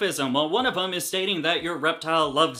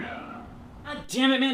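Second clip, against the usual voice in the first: slow, high drawn-out groan; weary groan; 9 semitones higher, slower than usual. Acted. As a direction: sigh it out in exasperation. Homeowner reacts to intruder.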